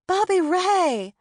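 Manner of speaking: high, loud, stretched joyful shout of his name; delighted cry. A narrator's reading. Delighted greeting of old friend.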